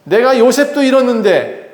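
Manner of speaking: the father refusing to lose another son, in a high angry voice; angry outburst. Acted. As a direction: refuse them, voice climbing high in anger. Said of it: "화를 내면서".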